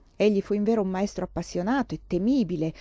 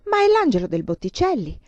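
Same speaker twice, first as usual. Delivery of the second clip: higher and louder in wonder; amazed; 5 semitones higher, 5 dB louder than usual. A narrator's reading. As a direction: marvel at the vision. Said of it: "immenso stupore". (meraviglia estatica, più alta)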